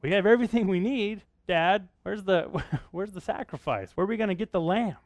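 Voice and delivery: Isaac slightly uneasy asking his dad; lightly voiced suspicious Isaac; suspicious son. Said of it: "he was probably getting a little suspicious".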